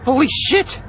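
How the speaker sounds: high, sudden shocked yelp; shocked exclamation. Acted. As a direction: blurt it out in shock. Shock at gun pulled out.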